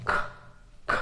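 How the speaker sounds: খ with only breath from the throat; airy খ, no voice; খ as aspirated k; demo breathy kh. Shown as a demonstration. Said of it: "শুধু কণ্ঠের বাতাসের ব্যবহারটা হয়".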